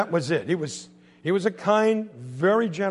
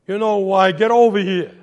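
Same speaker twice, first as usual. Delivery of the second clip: loud, angry Brooklyn dad; stern Brooklyn father's order; Brooklyn accent; 4 semitones higher, 12 dB louder than usual. Acted. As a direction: bark it in thick Brooklyn, pitched up. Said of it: "in his best Brooklyn".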